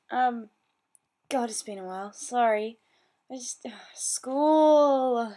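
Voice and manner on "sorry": ordinary talk, nothing marked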